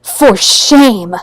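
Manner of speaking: hammed-up outrage; mock offended cry; mock scandalized. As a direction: clutch pearls and exclaim. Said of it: "scandalized gasp".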